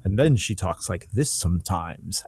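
lightly mocked normal-person voice; Maria Bamford. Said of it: "that's her clearly making fun of normal people or normal voices".

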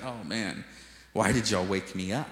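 imitating someone just woken, low and soft; low, weary mock grumble; groggy sleeper. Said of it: "he's asleep in the end of the boat".

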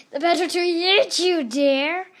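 spring the line like a wolf lunging; wolf yell. Acted. Wolf to Little Red before swallowing her, yelled. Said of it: "yelled the wolf".

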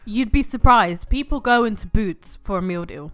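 loud insisting. Real, not acted. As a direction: push the point loudly. Insisting loudly in argument.

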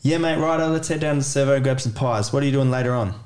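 quick, run-together Aussie reply; Australian conversation. Shown as a demonstration. Demonstrating Australian chat for learners, person two, quick Aussie slang. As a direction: answer your mate in rapid casual Australian. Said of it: "perhaps an Australian conversation".

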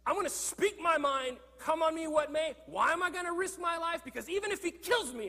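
high, loud, defiant shout; voicing Job's furious defiance; angry outburst. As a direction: fling the words up at God in anger. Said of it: "He's clearly angry with God".

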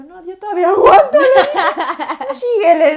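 high, loud mock protest; loud retold protest. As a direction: playfully shout it back at a partner. Retells defiant reply to partner.

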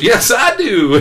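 loud, very high emotional cry-out; imitated tearful joy. Tearful doctor answering his healed patient, high and loud. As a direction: act out the doctor, overcome and exclaiming. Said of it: "He starts crying".

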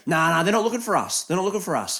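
mock druggie guy insisting it's fine; imitated junkie guy. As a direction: brush it off like a spaced-out dero. Guy's voice quoted in story.

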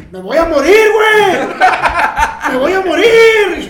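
high, loud wail that he's dying; terrified wail. Retold or imitated. Friend sure he is dying, high and loud wail. Friend fearing he'll die.

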